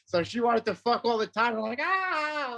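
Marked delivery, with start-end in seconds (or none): 1.8-2.5 high, drawn-out mock "ah"; high-pitched playacted yelp; mock overwhelmed cry. Acts out his young self overwhelmed.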